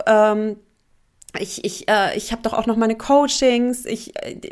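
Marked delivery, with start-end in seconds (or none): none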